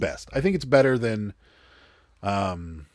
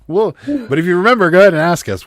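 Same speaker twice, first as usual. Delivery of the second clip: loud call; 9 semitones higher, 10 dB louder, faster than usual. Real, not acted. Calling out to viewer.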